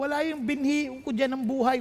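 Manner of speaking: ordinary delivery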